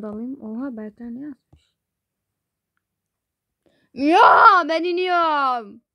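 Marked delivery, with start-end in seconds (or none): 3.9-5.8 loud, high whiny yell, drawn out slowly; bellowing. Acted. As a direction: bellow it in protest through the house. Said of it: "Niye böğürüyorsun?". (böğürerek, uzata uzata bağırma)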